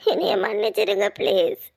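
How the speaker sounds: slow, crying "என்னைய மன்னிச்சிடுங்க" with a falling end; tearful plea. Acted. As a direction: beg through tears, slowly. Sister begging forgiveness before dying.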